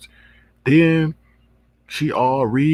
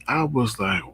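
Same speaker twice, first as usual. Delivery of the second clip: ordinary talk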